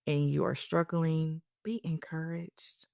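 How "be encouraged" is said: soft breathy whisper of encouragement. Real, not acted. Whispering encouragement to struggling listeners. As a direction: whisper it gently, as a blessing. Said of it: "encouraging struggling listeners".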